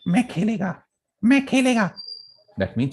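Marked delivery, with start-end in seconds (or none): 0.1-0.8 "Main khelega" squealed, much higher; "Main khelega" in a high boy's squeak; squeaky scream. Retold or imitated. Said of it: "screaming in a squeaky voice". 1.2-1.9 put-on high, squeaky kid's voice, much higher; a boy shrieking he will play; squeaky boy's scream. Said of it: "screaming in a squeaky voice".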